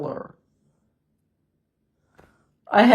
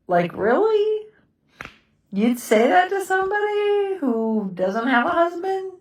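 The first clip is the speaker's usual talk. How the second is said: incredulous; 9 semitones higher than usual. Real, not acted. Reacting to friends' thoughtless remarks.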